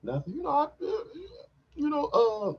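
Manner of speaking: put-on a high, puzzled girlfriend; lightly voiced woman asking; high mock woman's voice. Comic playing his partner, high puzzled question. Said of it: "She's like".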